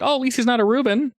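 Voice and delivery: high, quick imitation of relief; mock relief. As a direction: toss it off high and fast, mocking. Mimics a hypothetical verdict on NPCs.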